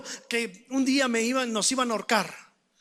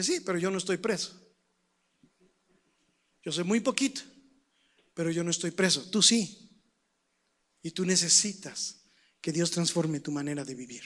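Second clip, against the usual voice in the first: low, soft and measured answer; quiet firm reply; 7 semitones lower, 6 dB quieter than usual. Retold or imitated. Young preacher answering a prisoner in jail, low and quiet. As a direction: answer gently but firmly, voice dropped low.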